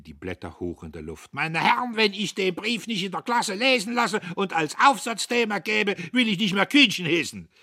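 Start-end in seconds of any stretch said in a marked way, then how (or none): 1.3-7.5 high, loud, excitable storyteller; puffed-up professor, shrill with excitement; boastful old professor. A narrator's reading. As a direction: crow the war tale at a high pitch. Professor Kühnchen proclaims.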